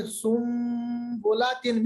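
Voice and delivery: slow سن بلاتن with n turned to m, sumbolatin; slow iqlab m. Shown as a demonstration. Teacher slowly models the iqlab in سن بلاتن. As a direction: drag out سن بلاتن with an m sound. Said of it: "ہم پڑھیں گے".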